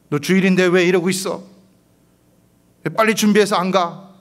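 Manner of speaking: high, loud scolding; mock scolding father. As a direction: scold the daughter as a father would. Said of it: "이래야 되겠습니까?". (딸을 다그치는 아버지, 크게 꾸짖듯)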